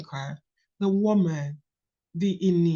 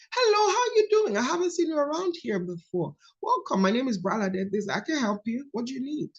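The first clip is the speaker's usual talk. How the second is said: much higher, bright welcoming greeter voice; friendly receptionist; 6 semitones higher than usual. Acted. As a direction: welcome a stranger sweetly at the front desk. Greeting a guest at work.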